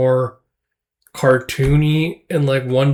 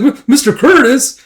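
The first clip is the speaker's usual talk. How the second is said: startled cry; 11 semitones higher, 6 dB louder than usual. Retold or imitated. Surprised cry at a sudden appearance.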